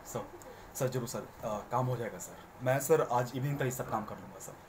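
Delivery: soft, low, humble employee voice; deferential to boss. Shown as a demonstration. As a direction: speak softly, bowing to the boss. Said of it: "dialogue delivery में थोड़ा softness है". (बॉस से झुककर नरमी से)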